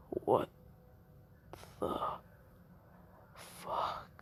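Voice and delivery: faint, breathless disbelief; dying whisper. Acted. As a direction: whisper it as life fades. Impaled Jugo, dying.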